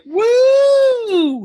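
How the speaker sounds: long, held high-pitched "woo"; high whooping cheer. Retold or imitated. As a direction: imitate a wedding guest whooping, way up high. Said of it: "the sister's like".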